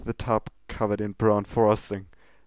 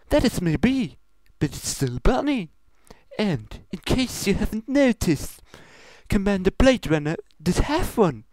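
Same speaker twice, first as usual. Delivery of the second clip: a touch higher, formal princess voice; lightly voiced royal Luna; 11 semitones higher, 4 dB louder than usual. Luna objecting to Pinkie, in a lightly formal voice. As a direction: speak with mild royal poise.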